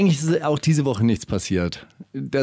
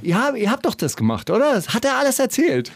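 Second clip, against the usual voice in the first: higher, needling cop voice; mock interrogator; 8 semitones higher than usual. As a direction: bluff the suspect with fake certainty. Vernehmer spielt Verhör nach. (Vernehmer blufft den Verdächtigen, höher und stichelnd)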